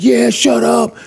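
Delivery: high-pitched mock crowd cheer; imitated crowd. Audience answers the host about hecklers, imitated cheer. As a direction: echo an audience egging him on. Audience shouting back, acted.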